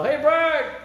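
high, loud call across the dark; yelled name. Retold or imitated. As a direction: shout his name from far off. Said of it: "somebody's yelling in the dark".

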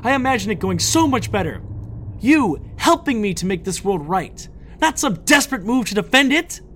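high, impassioned loud complaint; yelled. A narrator's reading. Villain lamenting the hero's refusal to help, yelling. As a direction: rail at someone who let you down. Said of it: "Lydia yelled from her place".

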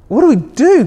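the dad panics even harder on the repeat; over-the-top fretful father, voice climbing; mock panic rising.

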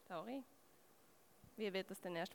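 ordinary talk, nothing marked

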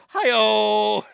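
loud, high sidekick catchphrase; Ed McMahon. Shown as a demonstration. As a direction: boom it out like a talk-show announcer. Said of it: "I love doing Ed McMahon".

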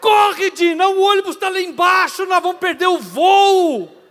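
frantic high yell to hurry his wife. Retold or imitated. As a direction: scream at her to run for the bus. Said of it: "preparei o primeiro berro". (gritando desesperado pra ela correr)

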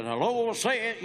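lightly hammed-up backwoods preacher call; imitating preacher dad. Son imitating his preacher father mid-sermon, mock call. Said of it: "my dad would get to preaching".

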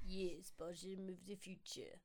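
slow, low, hesitant Russian accent; attempted Russian accent. Shown as a demonstration. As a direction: try a Russian accent, unsurely. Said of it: "do the accent for Alexei".

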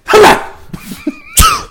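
fake sneeze attempt. Shown as a demonstration. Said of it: "That's a cough".

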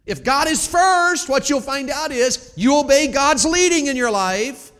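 high, shouted, slow sermon climax; impassioned pulpit shout; full-force preaching. Real, not acted. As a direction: preach it loud, stretching the key words.

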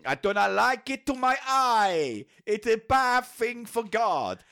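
put-on high, slow cod-accented pontiff; mock Pope. Pope ruling against the bikini, high hammed-up decree. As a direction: pronounce it slowly in a silly foreign accent. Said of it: "So say the Pope".

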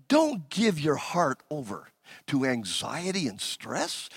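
low, soft, tender reassurance; calm them gently; soft pastoral pleading. Acted. Pastor calming anxious hearts in the pews, low and soft.

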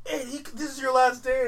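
put-on high, loud, slow near-crying child's wail; a loud, high, drawn-out child's cry of dismay; upset kid. Kid upset at friend leaving.

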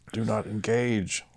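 slow, flat computer voice; glitching robot. Retold or imitated. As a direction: intone it like a ship computer. Computer warning voice.

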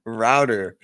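"Router" said the American way, ROW-der; American pronunciation. Shown as a demonstration. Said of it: "your pronunciation of, uh, router".

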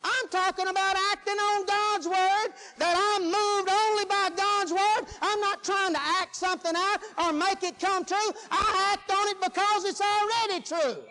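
high, driving preacher's proclamation; forceful preaching. Acted. Preacher explaining acting on God's word, forceful preaching. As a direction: drive each point home at full preaching pitch.

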